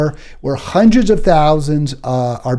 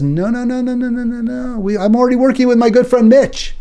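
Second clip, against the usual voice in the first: much higher whiny mock senator voice; mocking Chucky Schumer; 9 semitones higher than usual. Vlogger mocks the Senate leader siding with Republicans, high whiny mock voice. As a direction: play him as a high-pitched scold brushing off the left. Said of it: "Chucky Schumer says".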